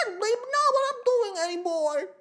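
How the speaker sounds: put-on very high, loud baby voice; exaggerated squeaky baby talk; baby impersonation. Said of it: "renowned baby impersonator".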